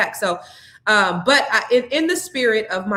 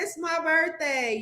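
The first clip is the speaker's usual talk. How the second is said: usual speaking voice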